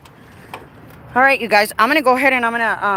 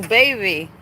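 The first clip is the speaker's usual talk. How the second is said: ordinary talk, nothing marked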